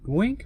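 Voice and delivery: short high game blip; quick high-pitched bloop; text noise imitation. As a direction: imitate a little Game Boy text sound. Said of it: "where it goes like".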